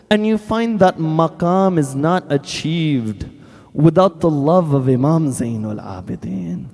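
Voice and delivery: normal talking voice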